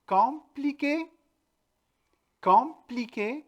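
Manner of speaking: teacher repeats the French word slowly; French pronunciation demo. Shown as a demonstration. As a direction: model Compliqué carefully, stretching it. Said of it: "El segundo ejemplo es compliqué".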